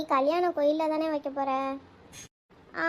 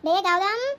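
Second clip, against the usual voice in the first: louder, higher call across the room; 4 semitones higher, 5 dB louder than usual. Acted. Aunt calls Gautham to scold him, calling out. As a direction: summon the boy sharply.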